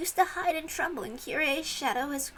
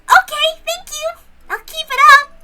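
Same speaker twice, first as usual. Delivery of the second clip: much higher and louder, beaming; cheerful thanks; 14 semitones higher, 17 dB louder than usual. Real, not acted. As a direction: thank them brightly and celebrate. Girl cheers after winning.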